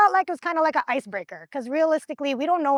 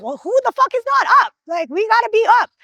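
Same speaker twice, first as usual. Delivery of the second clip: fast, higher, louder demand; indignant yell; 9 semitones higher, 8 dB louder, faster than usual. Retold or imitated. Shouting back at the team mid tug of war, fast and loud. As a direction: shout it back in angry disbelief. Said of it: "I'm like".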